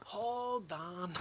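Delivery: usual speaking voice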